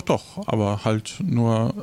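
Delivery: ordinary delivery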